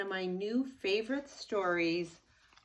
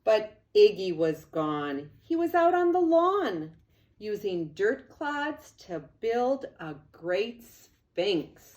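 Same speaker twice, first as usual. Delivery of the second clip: lively read-aloud; 3 semitones higher, 5 dB louder than usual. A narrator's reading. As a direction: read it like an excited storyteller to kids. Animated storybook narration.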